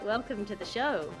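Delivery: usual speaking voice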